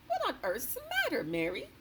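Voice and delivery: high, concerned royal voice; puzzled concern for a pale servant; the queen's voice. A narrator's reading. The queen asking the pale maid what is wrong, concerned.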